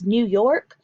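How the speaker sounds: ordinary delivery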